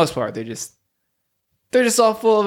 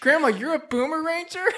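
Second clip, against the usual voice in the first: put-on higher, amazed young voice; surprised grandson; 12 semitones higher, 4 dB quieter than usual. Jimmy answering his grandma's call, surprised. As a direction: react in disbelief.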